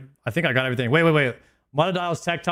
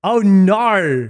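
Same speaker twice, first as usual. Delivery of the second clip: louder, higher mock Australian accent; 5 semitones higher, 7 dB louder than usual. American trying Australian on fellow streamer, exaggerated Australian accent. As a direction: put on a broad Australian accent. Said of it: "How, how's my Australian?".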